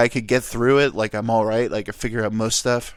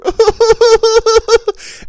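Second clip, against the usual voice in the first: loud, high sobbing wail; exaggerated ugly-cry blubbering; mock bawling; 11 dB louder than usual. Imitating dad bawling at a sappy commercial, wailing sobs. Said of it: "bawling his eyes out".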